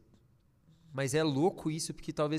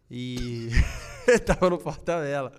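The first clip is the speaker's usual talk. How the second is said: laughing; 4 dB louder than usual. Real, not acted. Volta a rir do lapso.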